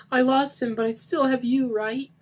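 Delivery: cling to him with the question; pleading. A narrator's reading. Lisa begging Mark not to leave. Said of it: "Lisa pleaded".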